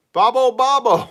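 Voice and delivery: loud, high sing-song call. Real, not acted. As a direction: call the name out across the room. Greeting a chatter by name.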